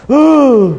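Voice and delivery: high loud startled ooh; Dustin's scared ooh. Retold or imitated. Imitating Dustin's scare, a loud high ooh. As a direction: yelp ooh like a spooked friend. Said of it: "He just goes".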